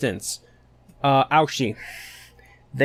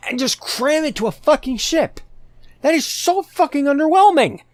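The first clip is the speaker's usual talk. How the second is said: much higher and louder, exasperated rant; outraged yell; 13 semitones higher, 4 dB louder than usual. Real, not acted. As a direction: blow up at how underwhelming it is. Ranting about the ship finale.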